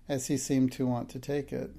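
ordinary delivery, nothing marked

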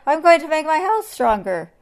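high, loud, urgent declaration; alarmed. A narrator's reading. Anteater resolving to protect his house, alarmed. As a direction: blurt out the plan in alarm.

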